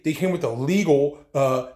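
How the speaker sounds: ordinary talk, nothing marked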